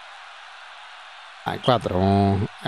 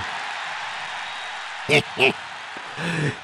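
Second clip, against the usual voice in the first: breathy laugh; 4 semitones higher, 7 dB quieter than usual. Real, not acted. Fourth goal.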